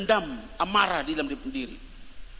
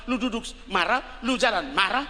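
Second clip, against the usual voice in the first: ordinary delivery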